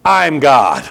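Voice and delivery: bold declaration. Retold or imitated. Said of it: "what that really means in Hebrew is".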